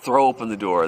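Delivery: ordinary delivery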